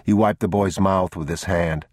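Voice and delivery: ordinary delivery, nothing marked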